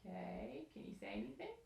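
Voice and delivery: whispered question, barely voiced; soft whisper. Real, not acted. Interviewer whispering a request to the traveler. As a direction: breathe the question without voice. Interviewer asks quietly, off mic.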